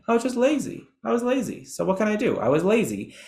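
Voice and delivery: high-pitched mock student excuse. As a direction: imitate a student shrugging it off, high then dropping. Voicing students' lazy excuse.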